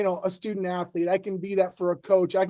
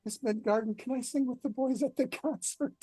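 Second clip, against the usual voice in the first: imitated squeaky seventh-grader, pitched up and softer; high squeaky voice; 5 semitones higher, 6 dB quieter than usual. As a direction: mimic your timid little choirboy self. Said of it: "this probably high, really squeaky voice".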